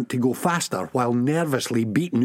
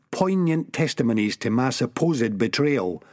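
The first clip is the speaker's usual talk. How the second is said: ordinary delivery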